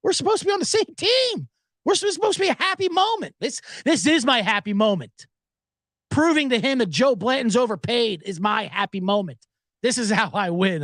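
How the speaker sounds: high, whiny peacemaker voice; pleading fan. Retold or imitated. Fellow fan begging the two arguing fans to stop, high pleading.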